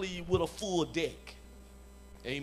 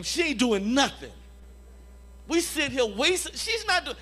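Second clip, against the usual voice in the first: retold impatient outburst, high and loud; impatient complaint; 8 semitones higher, 4 dB louder than usual. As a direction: act out an exasperated, pitched-up gripe to a partner. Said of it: "I was always ready to leave".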